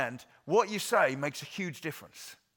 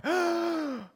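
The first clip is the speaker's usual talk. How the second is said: mock gasp; 6 dB louder than usual. Mock panic at challenges.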